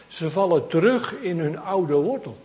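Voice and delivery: ordinary talk, nothing marked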